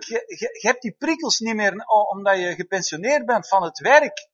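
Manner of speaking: ordinary delivery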